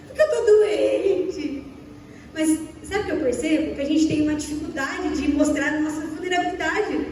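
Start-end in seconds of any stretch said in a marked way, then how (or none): 0.1-1.6 high, drawn-out whine; mock crying. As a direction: act out crying on the phone to the boss. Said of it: "Eu choro pra falar". (choro fingido, agudo e arrastado)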